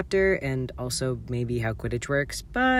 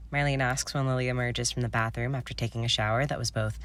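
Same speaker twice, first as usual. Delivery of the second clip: plain reading voice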